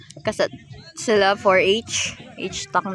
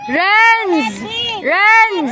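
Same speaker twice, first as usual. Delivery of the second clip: high, loud name call; 11 semitones higher, 6 dB louder than usual. Real, not acted. Spectator calling to a player, loud high holler. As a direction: holler the name across the court.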